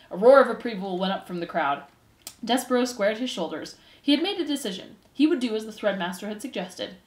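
plain reading voice

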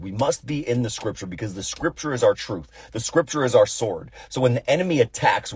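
building sermon. Acted. Pastor rallying the congregation to the Word, rising preaching. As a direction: drive the point home from the pulpit.

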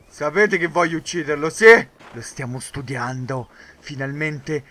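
ordinary talk

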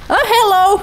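sudden cheery hello; surprised hello. Real, not acted. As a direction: pop up in happy surprise. Spots unemployed colonists.

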